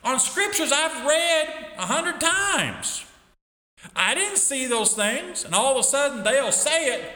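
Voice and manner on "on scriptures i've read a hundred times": slow, high preaching shout; high shouted slow emphasis; emphatic cry. Real, not acted.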